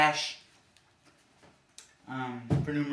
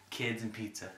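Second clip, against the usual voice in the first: usual speaking voice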